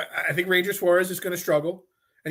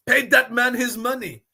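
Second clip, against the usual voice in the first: a hammed-up Russian accent, louder than his own talk; Rounders Russian accent; 4 semitones higher, 4 dB louder than usual. Radio host quoting Malkovich in Rounders, mock Russian accent. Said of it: "accent from Malkovich".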